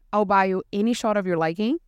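normal talking voice

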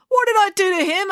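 high-pitched affronted question; incredulous protest. A narrator's reading. Officer answers April's accusation, incredulous protest. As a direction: ask it in disbelief at being blamed.

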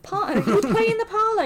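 laughing through the words. Real, not acted. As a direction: giggle while you say it. Jokey posh parlour remark.

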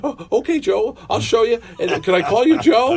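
high, eager and flustered; host fussing over his idol, high and eager; flustered fanboy. Retold or imitated. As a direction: fuss over him like a nervous fan. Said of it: "I'm like".

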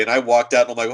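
ordinary talk

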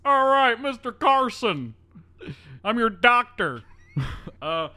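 high, loud cheery doctor; goofy doctor voice. Retold or imitated. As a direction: deliver bad news way too cheerfully. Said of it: "he's just like".

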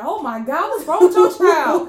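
loud, high-pitched mock dismay; mock appalled grandma. Blunt grandma seeing someone's baby, mock appalled. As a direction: blurt it out, playfully horrified, laughing at the end. Grandma reacting to an ugly child.

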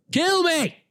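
loud, very high mock yell. Vlogger exasperated at own verbal tic, mock yelling. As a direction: yell it high in comic despair. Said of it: "I have to stop yelling".

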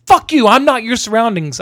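loud, high mock-furious comeback; upset outburst. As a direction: imitate the angry man snapping back loudly. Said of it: "significantly upset".

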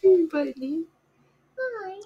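high, soft pet talk. Real, not acted. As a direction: coo to the cat. Talking to her cat.

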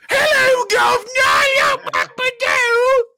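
hammed-up cockney accent, squeaky high falsetto shout; British exit. Host's mock British goodbye, shrieking a cockney accent. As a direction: screech a British hello. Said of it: "here is my British exit".

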